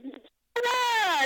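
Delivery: high, drawn-out thrilled cry; put-on elation; mock excitement. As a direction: imitate an excited winner, voice shooting up. Acting out excited prize winner.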